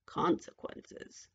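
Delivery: very slow, low, soft echo; menacing witch. A narrator's reading. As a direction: draw the threat back out slowly. Witch echoes the threat.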